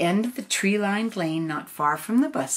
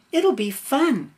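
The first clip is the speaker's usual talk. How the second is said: mom voice; 7 semitones higher, 5 dB louder than usual. Acted. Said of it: "said her mom".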